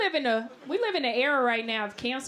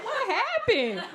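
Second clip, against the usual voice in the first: high, slow, stretched-out disbelief; mock baffled; 9 semitones higher, much slower than usual. Comic recalls workers ignoring her, slow baffled laugh. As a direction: drag it out, stunned and laughing. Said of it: "I was like".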